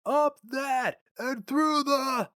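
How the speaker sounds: high, slow shout over factory din; shouting over noise. A narrator's reading. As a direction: yell it into his ear over the machines. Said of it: "cupped his hands around David's ear and shouted".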